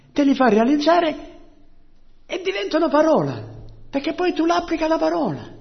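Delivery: high-pitched, impassioned preaching; fervent preaching. Real, not acted. Preacher tells the congregation how the word comes alive, fervently.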